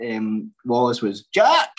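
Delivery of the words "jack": sudden high, loud squeal of a name; very high, loud character cry; high stage-character voice. Shown as a demonstration. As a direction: call it out in a high, put-on panto voice. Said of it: "talking in that high voice".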